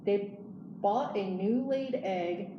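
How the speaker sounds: ordinary delivery, nothing marked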